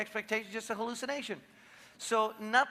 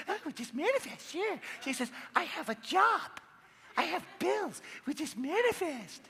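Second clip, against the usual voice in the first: higher, mocking skeptical wife; sarcastic wife; 9 semitones higher than usual. Wife mocking husband's manifesting, much higher and sarcastic. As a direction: scoff at his naive idea.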